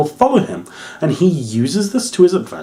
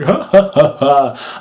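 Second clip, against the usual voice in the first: villain laugh; 3 semitones higher, slower than usual. Retold or imitated. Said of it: "He's like".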